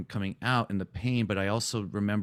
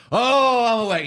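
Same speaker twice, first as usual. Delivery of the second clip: much higher and louder, a revivalist's exultant cry; evangelistic outburst; 9 dB louder than usual. Retold or imitated. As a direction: shout it like a sudden convert at a tent meeting. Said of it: "he was very evangelistic all of a sudden".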